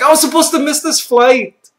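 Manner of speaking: high, loud wail of realization; retold yell. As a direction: yell it out in mock despair. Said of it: "I think I even yelled it out loud".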